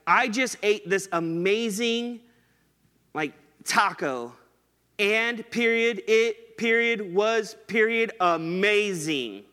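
mock text message, each word clipped off with a spoken period; texting-style word-by-word emphasis; emphasis on every word. Shown as a demonstration. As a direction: land on it, was, amazing one at a time, slowly. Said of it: "kind of have an emphasis on every word".

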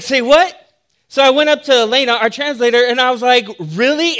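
loud, high disbelieving say what; incredulous. Retold or imitated. Pastor learns his joke meant beetle, loud shocked disbelief. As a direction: throw the question out in shocked disbelief.

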